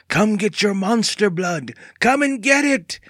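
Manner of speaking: high, teasing monster taunt; playful menace. A narrator's reading. Andy chasing Evan with the green pancake, playfully menacing. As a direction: taunt them in a high, put-on voice.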